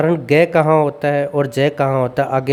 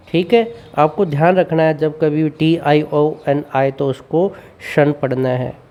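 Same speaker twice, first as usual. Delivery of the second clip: usual speaking voice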